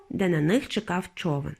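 usual speaking voice

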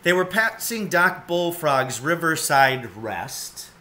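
plain reading voice

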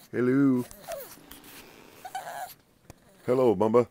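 loud high sing-song hello; calling out. Real, not acted. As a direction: call the pet's name brightly. Calling pet by name.

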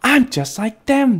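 high, delighted little voice; excited baby bat. A narrator's reading. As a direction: think it aloud with childlike glee. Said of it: "thought Stellaluna".

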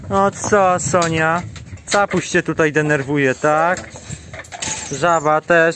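high sing-song voice for the goat; talking to the goat. Real, not acted. Farmer coaxing goat near dogs.

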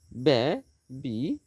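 "Baby" very slowly as বে, pause, বি; two long separate beats in "baby"; syllable by syllable. Shown as a demonstration. Teacher counting syllables for students, "baby" slowly split in two. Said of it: "দুটি syllable".

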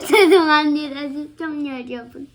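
loud laughing, near crying; laughing apology. Real, not acted. Child apologizes laughing to viewers.

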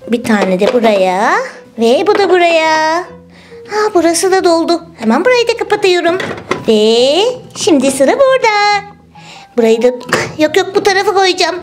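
ordinary delivery

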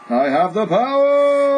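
loud, slow heroic shout; He-Man shout. Retold or imitated. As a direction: bellow it like a hero. He-Man quote.

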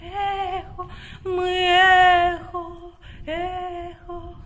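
high, slow, drawn-out calling; echo call. Pretend. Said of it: "Здесь такое эхо".

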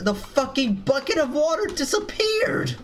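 high, incredulous outburst; outraged disbelief. Real, not acted. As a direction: pitch climbing in baffled outrage. Water bucket vanished.

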